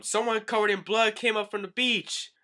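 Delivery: high, loud acted alarm; hammed-up alarmed woman; woman's cry. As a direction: cry out urgent news in a high voice. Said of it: "we hear a woman's cry".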